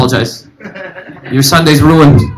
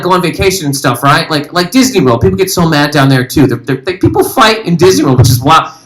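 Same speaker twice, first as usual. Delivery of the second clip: ordinary talk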